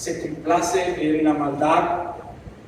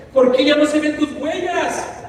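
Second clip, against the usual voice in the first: slow, higher pleading question; man asking his Master why the footprints vanish, pleading; 8 semitones higher, 4 dB louder than usual. Retold or imitated. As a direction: ask it slowly, hurt. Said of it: "el hombre le dice".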